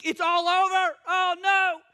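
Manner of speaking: high hammed-up alarm; exaggerated doom-laden wail; mock panic. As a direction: cry out as if all is lost. Acting out everyone's panic.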